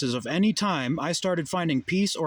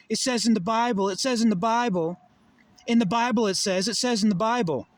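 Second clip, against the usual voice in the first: mocking parrot; 6 semitones higher than usual. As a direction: parrot it like a smug preacher's fan. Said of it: "stop just reading the fucking Bible".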